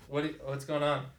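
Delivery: distant, far from the mic; muffled, away from the microphone; through a wall. Real, not acted. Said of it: "why do you sound so far away from the mic".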